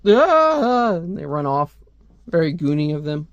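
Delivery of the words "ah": high cartoon "ah"; mock scream. Soldiers running off from the armor, high mock scream. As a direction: cry out high as if fleeing. Characters cry out and flee.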